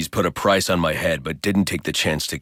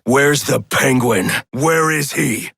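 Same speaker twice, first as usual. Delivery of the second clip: higher, louder, slow furious shout; interrogation shout; 11 semitones higher, 6 dB louder, slower than usual. Acted. Batman demanding Penguin's whereabouts from a thug, furious shout. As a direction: roar the question into his face.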